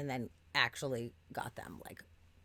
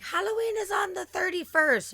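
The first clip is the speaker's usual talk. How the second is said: much higher mock voice of the worker; imitating the worker; 8 dB louder than usual. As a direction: mimic her matter-of-fact correction in a high voice. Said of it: "then she's like".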